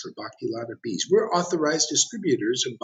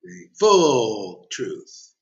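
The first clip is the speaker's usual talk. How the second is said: the full truth drawn out very slowly, louder; emphatic; 5 dB louder, much slower than usual. Acted. Preacher to the assembly, slow emphatic proclamation. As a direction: lean hard on each word, slowly. Preacher stressing his point.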